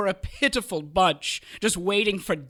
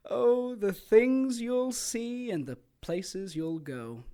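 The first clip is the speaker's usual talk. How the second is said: mock wistful; 3 semitones higher, 5 dB quieter, slower than usual.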